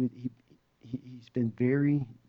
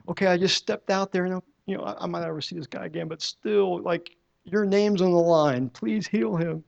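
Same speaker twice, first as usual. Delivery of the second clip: high, earnest plea to God; anxious pleading prayer; 6 semitones higher, 4 dB louder than usual. Retold or imitated. Praying after laying hands on the man, high and pleading. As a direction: beg God, voice lifted high.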